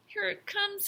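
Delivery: high, tense inner voice; anxious thought. A narrator's reading. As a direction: think it aloud, bracing for trouble. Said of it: "he thought".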